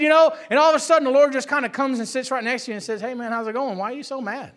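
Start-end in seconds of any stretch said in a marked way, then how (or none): 3.0-3.8 soft, softer, kindly buddy greeting; gentle Lord. Acted. The Lord voiced gently in sermon. 3.8-4.5 soft, much softer, caring inquiry; gentle question. Acted. The Lord gently questions him.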